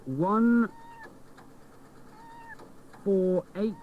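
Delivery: high, slow, drawn-out numbers; suspenseful reveal. Real, not acted. Presenter reading the winning number, high and slow for suspense. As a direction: stretch each number for suspense.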